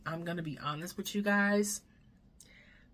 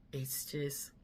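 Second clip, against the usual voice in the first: usual speaking voice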